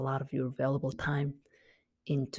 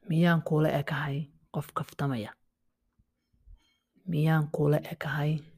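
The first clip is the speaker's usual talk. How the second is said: whispered question. Shown as a demonstration. Child asking the dad, whispered in disbelief. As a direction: hiss it under your breath. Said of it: "you're like".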